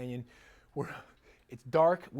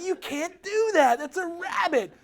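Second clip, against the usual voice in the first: high, slow, louder scolding; scolding the dog; 13 semitones higher, 11 dB louder than usual. Retold or imitated. Owner scolding dog for chasing rabbit, high exasperated scolding. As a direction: chide her like an exasperated owner.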